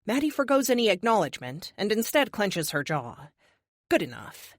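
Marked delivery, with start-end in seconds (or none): none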